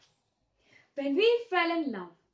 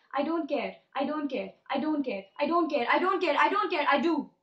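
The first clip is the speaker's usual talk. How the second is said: insistent repeats, growing louder and more urgent; building denial; 4 dB louder than usual. Acted. Poet repeating it inside her head, building denial. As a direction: build from measured denial to a loud, rushed outburst.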